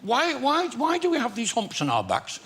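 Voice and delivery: high, lightly voiced Midlands camel; camel with a Midlands accent. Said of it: "two camels talking to each other".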